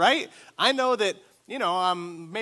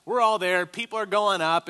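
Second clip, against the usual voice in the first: ordinary talk, nothing marked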